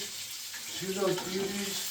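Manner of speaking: soft, slow, drawn out; slow soft admiring. Shown as a demonstration. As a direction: savour each word softly. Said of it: "see those beauties repeated".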